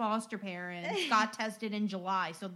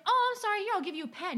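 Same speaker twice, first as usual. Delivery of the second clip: much higher and louder, quick apologetic burst; flustered apology; 11 semitones higher, 5 dB louder, much faster than usual. Retold or imitated. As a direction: squeak out a hurried sorry. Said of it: "I'm like".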